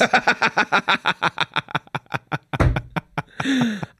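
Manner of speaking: long real laughing fit; hard laughing. Real, not acted. Storyteller cracking up at the diver's answer, hard laughter. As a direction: laugh helplessly at your own punchline. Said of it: "I've never laughed harder".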